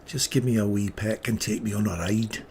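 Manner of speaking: amateur Scottish lilt; bad Scottish accent. Trader mimicking a Scot asking lass for a peck, bad Scottish accent. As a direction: put on a rough-and-ready Scottish accent. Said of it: "my Scottish accent sucks".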